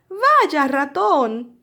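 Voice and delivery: high, loud storybook hare; hammed-up high-pitched hello; hare's greeting. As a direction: greet an old friend as an animated hare. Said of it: "dice Liebre".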